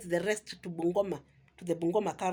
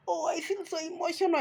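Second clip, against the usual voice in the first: high, put-on overcome voice; mock emotional; 7 semitones higher than usual. Vlogger mimicking the tearful guest, mock emotional. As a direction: imitate someone making a show of being moved.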